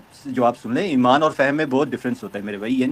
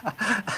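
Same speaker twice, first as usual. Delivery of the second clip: brief, soft chuckle; real stifled little laugh; short laugh; 6 semitones higher, 9 dB quieter than usual. Real, not acted. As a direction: let out a quick quiet laugh at a name. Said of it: "سفیان بن عیینہ کے نام پہ ہنس رہے ہیں".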